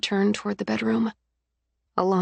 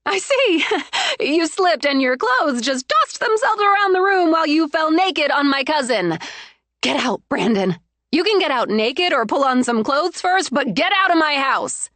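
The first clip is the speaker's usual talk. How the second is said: much higher, loud, mocking laugh into anger; scornful fury; 8 semitones higher, 4 dB louder than usual. Betrayed bride mocking cheating fiancé, furious. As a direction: sneer then throw him out. Sonia mocking then ordering out.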